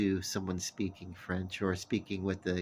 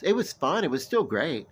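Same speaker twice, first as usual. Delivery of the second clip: excited burst; 7 semitones higher, 7 dB louder than usual. Real, not acted. Cheerful recovery after a mishap.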